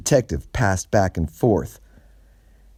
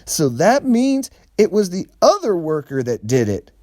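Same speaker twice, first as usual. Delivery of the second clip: much higher eager sidekick voice; Big Boy voice; 11 semitones higher, 4 dB louder than usual. A narrator's reading. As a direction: blurt the wrong conclusion proudly. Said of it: "said Big Boy".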